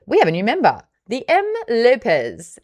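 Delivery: excited announcement. Real, not acted. Host introducing a new book club member, excited announcement.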